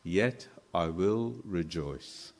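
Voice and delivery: soft and slow, a changed voice; quiet resolve. Acted. Habakkuk answering God after the lament, soft and slow. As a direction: let it fall hushed and slow, a quiet vow.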